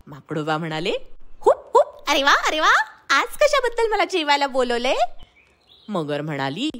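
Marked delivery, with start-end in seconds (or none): 1.4-5.0 high, bouncy monkey voice with hoops; a playful little monkey calling out; chirpy high monkey hooting hello; cheerful monkey. Acted. Monkey delighted by invitation.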